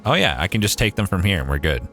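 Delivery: ordinary delivery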